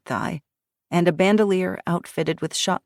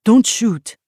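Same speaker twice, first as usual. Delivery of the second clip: urgent plea; 6 semitones higher, 8 dB louder than usual. Acted. Man pleads at gunpoint.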